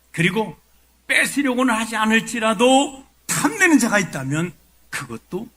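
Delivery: high, loud kingly declamation; acted royal outrage; raging King Saul. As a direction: warn every rival at full voice as the king.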